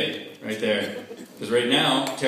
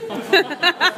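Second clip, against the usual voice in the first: high, loud stunned cry; shocked outburst; 5 dB louder, slower than usual. Retold or imitated. Kid stunned by the pencil thief's demand, shocked. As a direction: blurt it out in shock. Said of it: "I was like".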